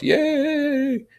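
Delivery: put-on high, excited child's cheer; boys' cheer. The little boys cheering Ralph's promise of rescue. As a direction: whoop for joy.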